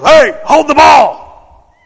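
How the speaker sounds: loud, high, slow holler; dad's shout. Retold or imitated. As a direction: holler it across the crowd. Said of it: "my dad goes".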